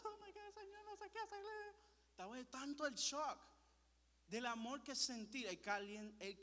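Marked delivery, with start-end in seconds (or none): 0.0-1.5 very high-pitched whiny wail; mock crying. Son overwhelmed by his parents' hug, mock crying wail. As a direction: squeal it like a put-on sob. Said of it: "me hago que el lloro".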